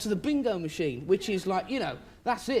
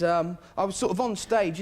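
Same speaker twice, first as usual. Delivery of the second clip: ordinary delivery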